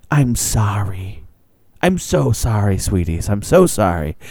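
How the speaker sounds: slow, whispered sorries to the sweeties; whispered apology. Retold or imitated. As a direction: whisper the apology as the dazed clerk. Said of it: "And she goes".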